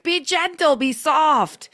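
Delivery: high, loud mock scream. To her stretch class, mocking herself with a loud, high scream. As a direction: bellow the soothing words as a joke. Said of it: "screaming to be gentle".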